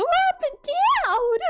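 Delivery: playful high swoop with a crack; voice going up and down. Shown as a demonstration. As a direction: swing the pitch up, then down. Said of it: "I like making my voice go".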